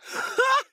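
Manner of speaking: loud, high bark of a laugh; one sharp mocking laugh. A narrator's reading. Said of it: "Хохотнула, будто рявкнула". (резкий лающий смешок)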